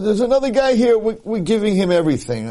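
high, loud retold outburst; acted protest.

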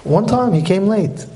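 ordinary talk, nothing marked

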